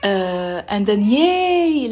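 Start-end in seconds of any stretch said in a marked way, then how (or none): 1.0-1.9 high, loud, drawn-out "yay"; long joyful exclamation; happy cheer. Shown as a demonstration. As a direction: cheer with rising delight. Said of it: "if you're happy".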